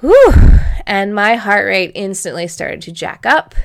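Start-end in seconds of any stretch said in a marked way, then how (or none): 0.0-0.3 loud, high-pitched whew of release; big relieved whew. Real, not acted. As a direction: blow it all out in one loud breath. Exhale after tense moment.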